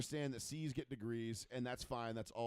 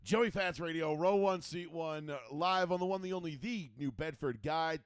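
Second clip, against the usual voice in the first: louder announcer-style show opener; radio show intro; 4 dB louder than usual. Acted. Host opening the show to listeners, announcer voice.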